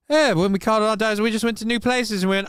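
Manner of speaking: high, lightly put-on pompous British voice; Eddie Izzard style posh Brit. Britain boasting to the world, in a high mock posh voice. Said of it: "That's an Eddie Izzard bit".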